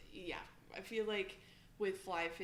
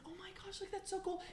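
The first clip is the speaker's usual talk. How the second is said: much higher, thrilled dad voice; excited dad; 12 semitones higher than usual. Retold or imitated. Dad thrilled about his daughter's fish, high gushing voice. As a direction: gush like a proud dad over the catch. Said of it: "he's like".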